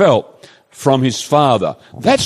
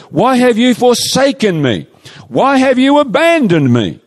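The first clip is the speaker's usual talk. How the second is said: loud cry; 8 semitones higher than usual. Retold or imitated. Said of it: "with a loud voice".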